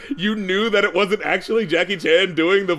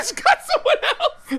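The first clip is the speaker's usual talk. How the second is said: very high words squeezed through laughter; real helpless laughter. Real, not acted. Host reacts to voiceover stand-in joke, laughing hard. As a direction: barely get it out while laughing.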